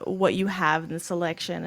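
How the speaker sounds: usual speaking voice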